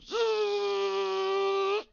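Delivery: high buzzing zzz of a power window; window motor noise. Retold or imitated. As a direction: buzz like an electric window sliding down. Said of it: "the driver window goes".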